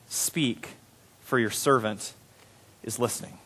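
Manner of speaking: low, soft, spaced phrases; hushed reverence. A narrator's reading. Samuel answers the Lord, hushed reverence. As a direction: answer softly, pausing between phrases.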